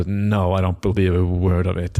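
ordinary talk, nothing marked

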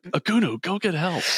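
very high-pitched mock British cry for help; British accent. British surfer begs staring friend for help, British accent. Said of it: "[name] is speaking with a British accent".

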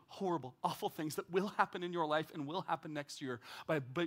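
ordinary talk

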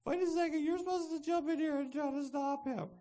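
high, loud mock whine; hammed-up wailing protest; indignant passive victim. Victim demanding to be rescued, high indignant whine. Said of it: "that passive victim".

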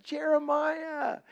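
high, drawn-out sing-song call of his name. Retold or imitated. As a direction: stretch the name out slowly, sweet and high. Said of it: "she comes up and she's like".

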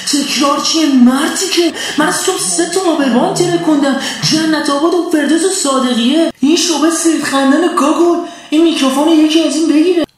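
furious high shout up at the sky. Acted. He shouts up at the sky at the commentator, furious. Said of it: "رو به آسمون داد زدم".

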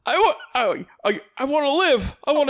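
high-pitched mock plea; hammed-up desperate George; pleading movie hero. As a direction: stammer it out, begging to live. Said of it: "he's like".

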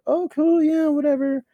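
high, airy, offhand shrug; breezy dismissal. Retold or imitated. Told to run for Mister Precinct, a high airy shrug. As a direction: toss it off lightly way up high. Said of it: "And I was like".

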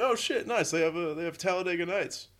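high, delighted exclamation over a great find; excited find. Retold or imitated. Finding Talladega Nights among the DVDs, high and thrilled. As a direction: squeal it like you just found treasure. Spotting a favorite movie DVD.

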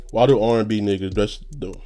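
normal talking voice

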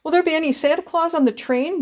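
put-on a little girl close to tears; tearful little girl. A narrator's reading. Small sister asking the passengers about Santa, tearful child voice. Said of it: "demanded his small sister tearfully".

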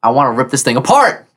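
frustrated. Pretend. Disappointed player wants to attack, frustrated. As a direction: vent it, rising toward the end.

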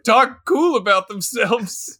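ordinary talk, nothing marked

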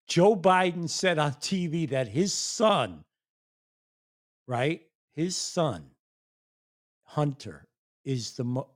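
ordinary delivery

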